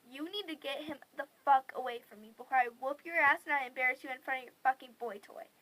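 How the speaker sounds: loud retold ultimatum; angry threat. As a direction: threaten her loudly in one rush.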